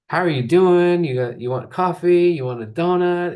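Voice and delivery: friendly donut shop lady; cheery shop staff. Retold or imitated. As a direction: greet a customer brightly at the counter.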